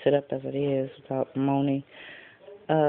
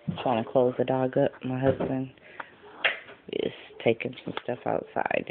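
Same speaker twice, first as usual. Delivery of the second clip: ordinary delivery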